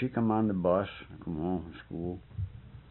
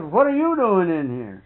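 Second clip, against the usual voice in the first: higher, louder startled question; startled girl; 6 semitones higher, 6 dB louder than usual. Retold or imitated. Girl catching the worker inside the house. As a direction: catch him off guard, sharply. Surprised girl confronting him.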